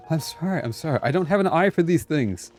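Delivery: ordinary talk, nothing marked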